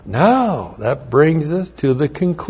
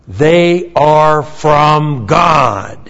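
slow emphatic; much slower than usual. Acted. Preacher slows on key line.

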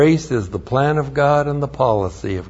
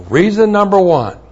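preaching emphasis; 6 semitones higher, 6 dB louder than usual. Real, not acted. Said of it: "preaching emphasis".

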